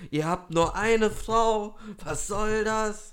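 loud, high, drawn-out outrage; hammed-up slow protest; mock hate mail. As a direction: imitate an indignant listener's complaint. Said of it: "jetzt kriegt so Tide die ganze Zeit, äh, Hassmail so".

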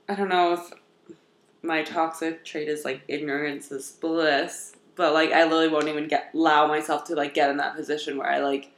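low, soft sheepish admission; quiet confession. Real, not acted. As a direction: confess it under your breath.

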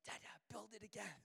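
imitated toddler's hushed request; small child. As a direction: plead quietly like a three-year-old. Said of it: "he looks at me and says".

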